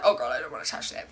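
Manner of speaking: disgusted. Retold or imitated. Mom-to-be voicing her own food disgust, quick and grossed-out. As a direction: shudder at the thought of touching food.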